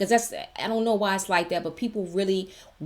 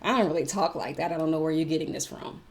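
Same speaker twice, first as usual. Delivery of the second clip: ordinary delivery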